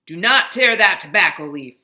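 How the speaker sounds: loud furious bark; boss yelling. Acted. Factory boss yelling at the new boy. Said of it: "the boss yelled at the new boy".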